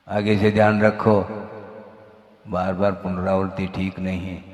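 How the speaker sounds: low, measured warning; changed, admonishing tone. Shown as a demonstration. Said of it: "tone बदल गया". (धीमे स्वर में चेतावनी)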